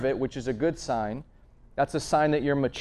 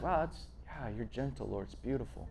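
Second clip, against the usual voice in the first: hushed awe; 2 semitones lower, 13 dB quieter than usual. Retold or imitated. Quiet awe toward God.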